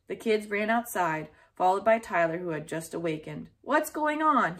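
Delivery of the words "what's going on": ordinary delivery, nothing marked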